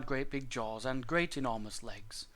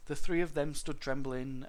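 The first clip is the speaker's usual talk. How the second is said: ordinary delivery, nothing marked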